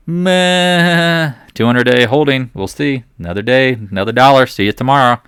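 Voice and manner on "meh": dismissive meh. Acted. Dismissive grunt.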